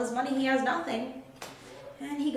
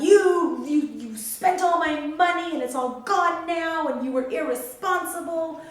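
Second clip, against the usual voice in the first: a louder, mock-scolding father; angry father; 3 semitones higher, 6 dB louder than usual. The father scolding the son who wasted his money, mock angry. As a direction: scold the son in a hammed-up rebuke. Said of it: "every right to be mad at his son".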